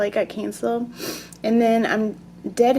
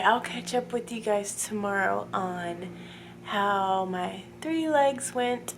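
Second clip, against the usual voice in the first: ordinary talk, nothing marked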